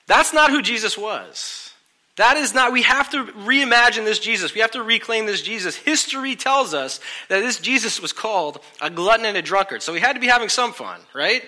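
high, loud preacher drive; rally the congregation; loud sermon build; fiery preaching. Acted. Preaching at full force.

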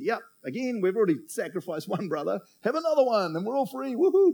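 high, breezy glee rising to a whoop; mock carefree cheer. Brothers happily giving up another brother, mock carefree cheer. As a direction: act gleefully unbothered, pitch climbing to "Woohoo". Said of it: "They could have easily said".

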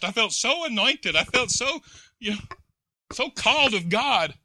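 high, loud, rising preacher's cry; preaching at full force. Acted. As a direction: preach it out with mounting fervor.

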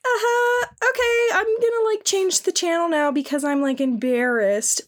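high, put-on girly voice; high mock girl voice, embarrassed; mock anime girl. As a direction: squeak it out like a flustered anime girl. Voicing the girl in the hentai.

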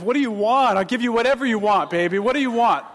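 high, loud eager offer; eager pleading. Retold or imitated. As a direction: beg her to name anything. Pleading with daughter.

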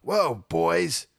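impression of the teacher giving the boys a loud lesson; American major lecturing. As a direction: hand down life advice like a gruff old US officer. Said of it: "he goes".

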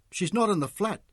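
high-pitched agitated outburst; urgent and distressed, pitch climbing. A narrator's reading. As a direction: reel it off, increasingly worked up. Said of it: "Mick had become more agitated".

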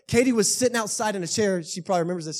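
usual speaking voice

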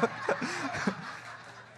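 unvoiced laugh; breathy laugh. Real, not acted. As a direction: laugh at own joke. Laughs at own joke.

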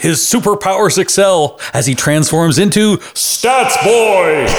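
mock superhero reveal, swelling to a shout; announcer climax. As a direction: build to a high, drawn-out shouted name. Superhero intro builds to name.

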